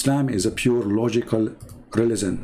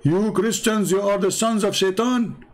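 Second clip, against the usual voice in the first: higher accusing quote; lightly voiced heckler accusing; 7 semitones higher than usual. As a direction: fling the accusation like him. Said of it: "he say the same".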